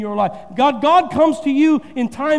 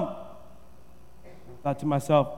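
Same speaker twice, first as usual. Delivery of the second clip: inner aside; 8 semitones lower, 11 dB quieter, much slower than usual. Real, not acted. Inner thought, whispered aside.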